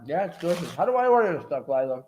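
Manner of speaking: words muffled by a bite of food; speaking with a mouthful; mouth full. Real, not acted. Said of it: "I'm talking in my mouth".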